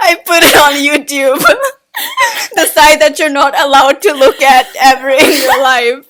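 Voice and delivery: loud, helpless laughing through the words. Real, not acted. Host cracking up at own joke about YouTube, laughing loudly. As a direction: crack up while pushing the line out loudly.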